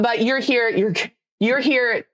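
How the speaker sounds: usual speaking voice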